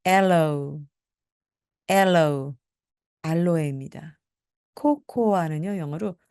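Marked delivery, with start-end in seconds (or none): 0.0-2.5 "aloe" punched on AL, falling after; front-stressed. Shown as a demonstration. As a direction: lean hard on the first syllable of "aloe". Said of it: "앞을 강하게 해 주시기". ("aloe"의 앞을 강하게)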